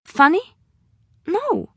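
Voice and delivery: sharp rising "Funny?" then a clipped "No."; indignant surprise. Acted. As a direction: pitch jumps high in offended disbelief. Offended reaction to a joke.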